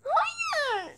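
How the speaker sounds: despairing no. Real, not acted. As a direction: wail it like all hope is gone. Fails again in game. (yüksek ve çok tiz umutsuz hayır)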